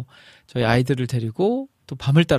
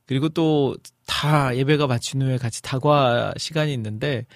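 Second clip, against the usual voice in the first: ordinary delivery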